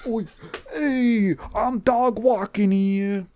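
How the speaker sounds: a cartoon New Yorker's New York accent. Comic asking why no one has a New York accent, mock New York accent. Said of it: "No one has a New York accent".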